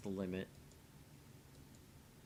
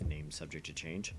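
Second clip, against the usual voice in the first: dry aside; 6 semitones lower, 5 dB quieter than usual. Acted. Parenthetical aside in game text.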